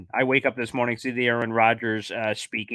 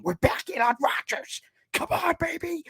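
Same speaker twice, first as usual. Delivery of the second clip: excited whisper. Acted. Hyped fan whispering.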